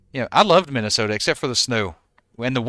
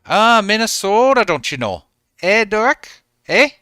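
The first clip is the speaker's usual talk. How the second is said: hammed-up Minnesota accent, pitched higher and louder; mock Minnesota accent, higher, louder and slower; 9 semitones higher, 5 dB louder than usual. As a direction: play up a Minnesota accent with stretched vowels. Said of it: "That was a Minnesota accent".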